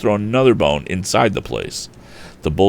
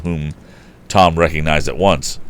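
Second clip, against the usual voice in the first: plain reading voice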